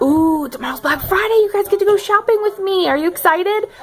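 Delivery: excited. Real, not acted. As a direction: hype up the viewers.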